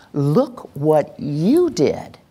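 very slow, marveling praise; celebrating. Shown as a demonstration. Adult celebrating a child who followed a command, marveling praise. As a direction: stretch it out, leaning on "you". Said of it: "we want to celebrate them".

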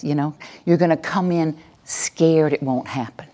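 ordinary delivery

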